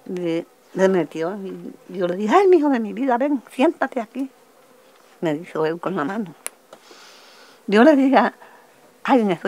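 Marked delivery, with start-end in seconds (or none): 2.4-4.3 high-pitched anxious plea, re-enacted; retold motherly call. Mother to her son, re-enacted high urgent call.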